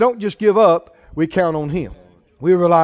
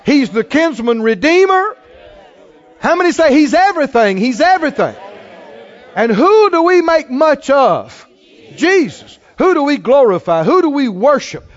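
preacher lifting his church toward a shout; rising preaching; 4 semitones higher than usual. Acted. Preacher to congregation, building to a joyful shout.

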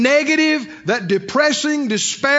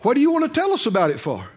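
ordinary talk

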